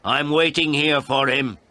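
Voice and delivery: high, loud insistent call; shouting. A narrator's reading. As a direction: shout it at someone behind a door. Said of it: "I shouted at her".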